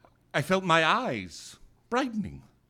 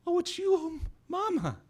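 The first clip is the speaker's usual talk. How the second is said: put-on childlike. Child recognizing mother.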